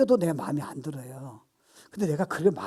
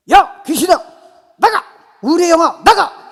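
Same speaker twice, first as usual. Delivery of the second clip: high, explosive commanding shouts; rebuking evil spirits; 13 semitones higher, 16 dB louder, slower than usual. Shown as a demonstration. Believer ordering the spirit of depression out, rebuking shout. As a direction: fire off each command at full voice. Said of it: "이렇게 나오는 거예요".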